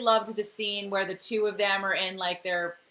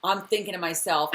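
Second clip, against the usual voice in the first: ordinary talk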